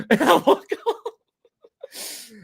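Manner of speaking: high, slow, helpless laughing. Real, not acted. Storyteller laughing at his own sister joke, laughing. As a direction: crack up while getting the words out.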